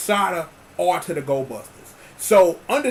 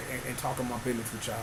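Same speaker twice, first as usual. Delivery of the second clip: normal talking voice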